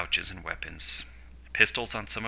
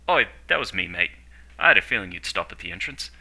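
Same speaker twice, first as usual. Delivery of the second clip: loud, cheeky owning up with a smile; quirky, pleased admission, louder; 9 dB louder, faster than usual. A narrator's reading. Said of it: "he said with a quirky smile".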